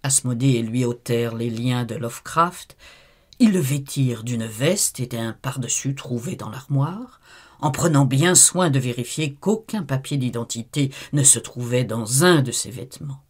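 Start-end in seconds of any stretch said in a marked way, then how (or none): none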